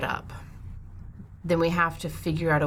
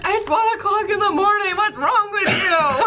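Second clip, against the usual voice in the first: very high, loud, drawn-out screech; yelling neighbour; 6 dB louder than usual. Acted. Neighbour complaining at one in the morning, high screeching shout. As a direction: shriek your complaint through the wall.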